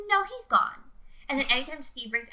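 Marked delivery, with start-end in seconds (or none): 0.1-0.8 put-on insistent old lady. Retold insistent old woman.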